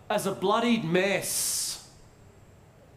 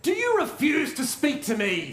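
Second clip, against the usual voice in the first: put-on high, indignant British accent; British bad-guy accent; 6 semitones higher than usual. Pilate to silent Jesus, indignant British villain accent. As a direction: play an affronted British villain, pitched up. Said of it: "all the bad guys have British accents".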